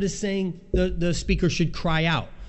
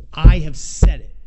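much louder, God laying down the final word; booming declaration; 3 semitones lower, 13 dB louder than usual. Acted. The Lord declaring his word to Isaiah, booming. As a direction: proclaim it like thunder.

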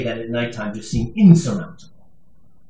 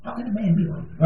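mock confusion; 7 semitones higher, 8 dB quieter than usual. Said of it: "none of you are going".

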